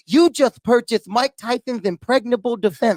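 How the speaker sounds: Mike Tyson. Acted. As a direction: deliver a mock ad in a boxer's lisp. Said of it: "Mike Tyson comes and punches him".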